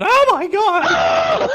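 high, loud, slow drawn-out scream; mock scream. Running down the road screaming in mock panic. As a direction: shriek it long and slow. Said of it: "start screaming".

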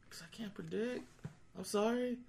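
high, soft, helpless little voice; mock meek apology. Winner excusing himself to an angry gambler, mock meek apology. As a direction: shrink into a tiny apologetic voice.

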